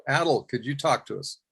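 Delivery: ordinary talk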